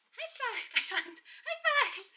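high, laughing, squealed command; squeaky laughing command. Real, not acted. Dog tricks, repeated command.

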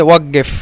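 "ওয়াক্কেফ" said as waggef; Saudi pronunciation. Shown as a demonstration. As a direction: voice the doubled middle of "ওয়াক্কেফ" as g. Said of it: "ওই সৌদিরা বলে".

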